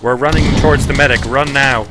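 loud, urgent call to run; urgent shout. Real, not acted. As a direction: yell the order over gunfire. Urgent call to run under fire.

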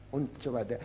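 ordinary talk

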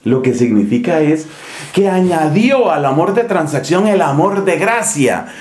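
normal talking voice

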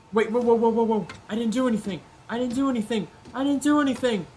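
high frantic protest; panicked. Real, not acted. Caught by guard.

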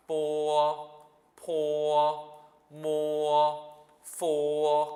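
"뽀어, 포어, 모어, 포어" long, high and level; first-tone drill. Shown as a demonstration. Teacher drilling four initials, slow high level tone. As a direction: stretch each syllable on a loud steady high note. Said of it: "일 성을 연습하는 거래요".

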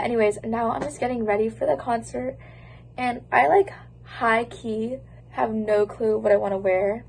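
ordinary talk

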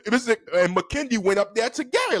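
ordinary talk

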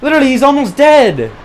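high, loud excited cry; excited shout. Real, not acted. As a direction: yell with hope. Boss almost dead.

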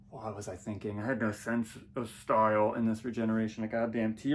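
normal talking voice

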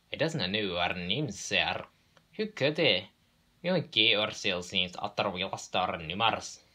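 cheeky Scottish engine voice; Scottish twins. Acted. Twins explain their names to boss, Scottish accent.

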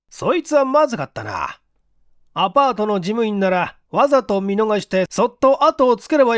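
a senior officer thinking aloud to his men; section chief. Acted. Section chief voiced by narrator.

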